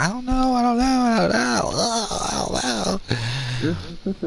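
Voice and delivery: high squeaky mock denial, dragging at the end; whiny kid imitation. Speaker mocks the boy's denials in a high whiny voice. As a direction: whimper it like a guilty kid. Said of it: "He's like".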